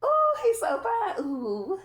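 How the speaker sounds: high, loud, slow swoon; squealing swoon. Real, not acted. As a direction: gush over him helplessly. Swooning again over him.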